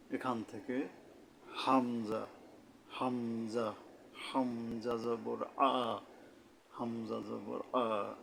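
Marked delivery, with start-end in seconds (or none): 1.5-8.1 slow, spelled-out হামজা জবর আ with a firm throat onset; firm articulation. Shown as a demonstration. Teacher spells out the vowel sign, slow and firm হামজা জবর আ. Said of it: "মানে একটু শক্তভাবে".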